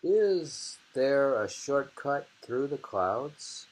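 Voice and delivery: slow, lifted haiku reading. Shown as a demonstration. Reading the next haiku to a friend, slow and lifted. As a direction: read the line slow and rising, asking the sky. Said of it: "Now we have".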